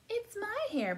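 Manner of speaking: high, loud dog voice; barking dog. A narrator's reading. Dog claiming its hair in a picture book, barking. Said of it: "barked the dog".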